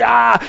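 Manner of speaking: short demon cry; a sharp mock scream from the possessed man; grito de demonio. Demonio sacude al hombre. (grito breve de endemoniado)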